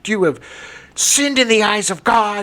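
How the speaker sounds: a nun berating a seven-year-old altar boy, mock fury; mock nun scolding. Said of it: "and screaming at me".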